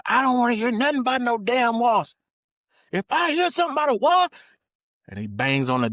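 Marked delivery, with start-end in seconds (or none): 0.1-4.3 loud, high furious yell; old man yelling. Retold or imitated. Grandfather shouting at the kids about the wasp, furious yell. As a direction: warn them off at the top of his voice. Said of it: "he says something to the ones".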